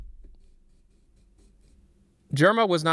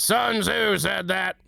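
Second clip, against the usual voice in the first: slower, a touch higher, lightly voiced army man; Soldier impression; 9 semitones higher, 3 dB louder, slower than usual. Streamer repeating the line, slow light soldier voice. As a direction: lean into it like a proud soldier. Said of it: "that's my favorite line is".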